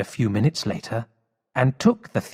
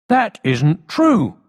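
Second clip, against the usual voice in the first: high, louder, slow protest; cried out; 8 semitones higher, 3 dB louder, slower than usual. Acted. The captain protesting to the police chief, crying out. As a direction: cry out against the lie. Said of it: "cried the captain".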